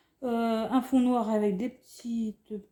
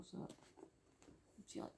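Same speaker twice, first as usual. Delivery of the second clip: slow, barely voiced whisper; 23 dB quieter, much slower than usual. Real, not acted. Vlogger admiring the little reindeer, whispering. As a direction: hush it, almost to yourself.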